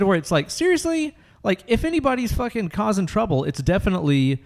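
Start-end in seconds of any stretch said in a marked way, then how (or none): 0.5-3.4 high, exasperated disbelief; incredulous. Real, not acted. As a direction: voice shoots up indignantly. Indignant at bag check.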